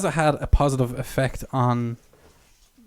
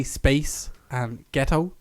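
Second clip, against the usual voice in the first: separate words; much slower than usual. Shown as a demonstration. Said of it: "but if you say it fast".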